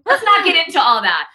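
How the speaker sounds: evasive celebrity brushing off questions; Hilaria impression. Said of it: "Now I sound like Hillary in her New York Times interview".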